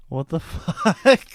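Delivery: high, slow disbelieving what the fuck broken by a laugh; shocked laugh. Retold or imitated. Watching the scene, she reacts in high slow laughing disbelief. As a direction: gasp out the words through a laugh. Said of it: "I'm just like".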